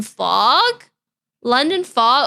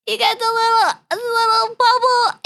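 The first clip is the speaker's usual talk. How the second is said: cutesy high voice; 10 semitones higher than usual. Acted. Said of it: "repeats in squeaky cute voice".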